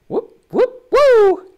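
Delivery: very high falsetto whoops; two quick high whoops into a loud woo; imitated whooping noises. Host imitating a swordsman's noises as he fights, playful high whooping. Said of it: "he just makes noises".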